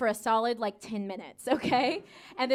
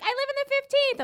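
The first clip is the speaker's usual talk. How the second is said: much higher mock-chirpy voice; perky newcomer; 12 semitones higher than usual. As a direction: imitate an over-cheerful newcomer, pitched high. Voicing a would-be friend's answer.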